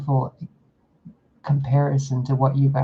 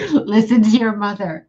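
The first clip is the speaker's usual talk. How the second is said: louder and higher, laughing through a parent's warning; a scolding that collapses into giggles; laughing mock scold; 6 semitones higher, 8 dB louder than usual. Real, not acted.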